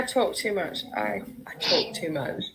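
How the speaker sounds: soft whisper with a laugh; a real embarrassed whisper; whispered aside. Real, not acted. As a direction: whisper it sheepishly to yourself. Apologising, laughing under breath.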